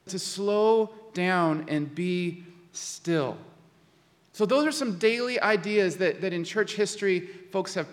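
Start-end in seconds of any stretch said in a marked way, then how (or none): 0.1-3.4 very slow, spaced-out words; slowed right down. Shown as a demonstration. Preacher urging listeners to be still, very slowly. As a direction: draw each word out, settling into stillness.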